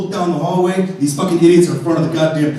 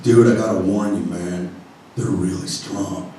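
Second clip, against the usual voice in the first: stoner voice; 12 semitones lower, 6 dB quieter than usual. Retold or imitated. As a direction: counter clerk drawling a warning. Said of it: "super stoner".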